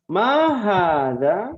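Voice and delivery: slow drill question. Shown as a demonstration. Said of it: "teacher drilling class".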